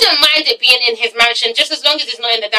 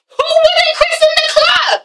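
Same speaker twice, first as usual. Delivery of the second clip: high-pitched exclaimed disbelief; incredulous outburst. Real, not acted. Vlogger challenging viewers over the club, incredulous outburst. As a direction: cry it out high, as if it's obvious.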